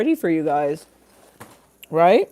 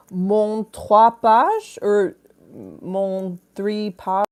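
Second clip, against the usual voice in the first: slow mock French; 5 semitones higher, 4 dB louder, much slower than usual. Host sounds out viewer's name in mock French, slow. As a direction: read it out slowly in hammy French.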